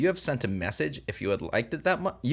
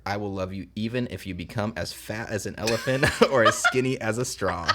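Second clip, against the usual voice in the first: romantic line broken up by real laughter; can't keep a straight face, laughing as you read; laughing through the line; 3 dB louder than usual. Real, not acted.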